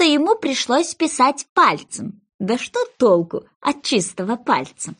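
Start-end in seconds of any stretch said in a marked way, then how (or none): none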